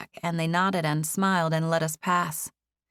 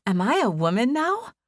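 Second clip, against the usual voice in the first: high, delighted question; light, thrilled disbelief; surprised and happy; 6 semitones higher, 4 dB louder than usual. A narrator's reading. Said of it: "looking surprised and happy".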